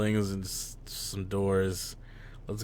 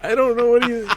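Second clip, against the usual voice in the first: flustered outburst; 13 semitones higher, 10 dB louder than usual. Real, not acted. Flustered at the potato insult.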